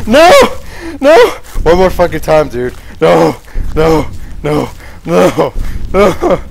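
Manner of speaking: high, loud frantic yells; real terror, shrieking while fleeing; panicked screaming. Real, not acted. Chased again, screaming in panic.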